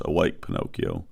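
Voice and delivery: a hushed, low "Awake, Pinocchio"; soft call. A narrator's reading. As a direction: gently wake him, barely above a breath. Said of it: "she says the words".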